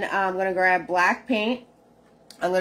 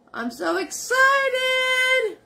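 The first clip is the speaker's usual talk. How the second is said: hammed-up excitement; much slower than usual. Cheering about the contest card.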